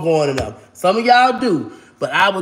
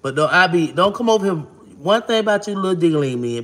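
usual speaking voice

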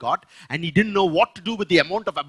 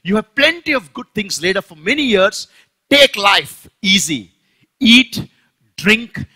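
loud, higher, slowing on take life easy; self-congratulating fool; 4 semitones higher, 7 dB louder than usual. Acted. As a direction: pat yourself on the back out loud. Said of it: "I will say to myself".

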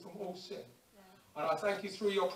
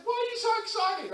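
high surprised question; 13 semitones higher, 3 dB louder than usual. Retold or imitated. Friend asks, high voice.

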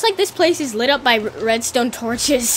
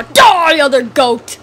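battle cry; 7 dB louder than usual. Pretend. Attacking another goat.

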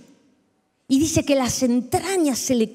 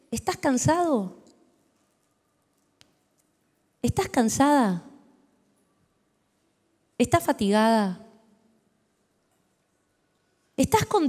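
tender voice of Jesus; 7 semitones lower than usual. Acted. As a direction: coax the tired listener like a loving shepherd. Preacher voices Jesus inviting tenderly.

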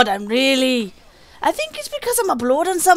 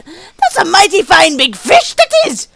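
a hint of a hammy old pirate, slow and louder; 3 semitones higher, 7 dB louder, slower than usual. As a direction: boom it out like a salty sea dog admiring a catch. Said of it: "I do sound Scottish, don't I?".

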